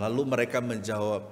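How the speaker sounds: ordinary talk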